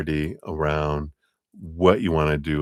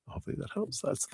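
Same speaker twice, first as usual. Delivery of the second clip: whisper; 13 semitones higher, 13 dB quieter, much faster than usual. Acted. Repeats sign-off as a whisper.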